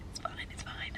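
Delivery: very quiet, voiceless whisper; whispered reassurance. Real, not acted. Vlogger reassuring herself about a rough day, whispered. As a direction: whisper it to yourself after a sigh. Muttered self-reassurance after a sigh.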